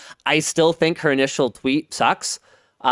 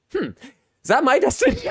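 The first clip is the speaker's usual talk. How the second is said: mock dramatic musing; 9 semitones higher, faster than usual. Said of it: "I was like".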